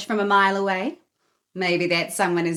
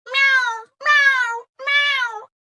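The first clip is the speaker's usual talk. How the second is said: very high, louder miaow; demanding cat meow; 7 dB louder, much slower than usual. A narrator's reading. Greedy Cat demanding food from Katy, high loud meows. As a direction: cry like a hungry cat. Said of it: "Greedy Cat cried".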